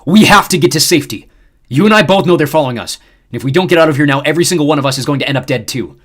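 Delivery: loud, stern warning; stern growl. A narrator's reading. Xander turning on the crew, sternly threatening and loud. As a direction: hold them with menace. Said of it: "he growled sternly".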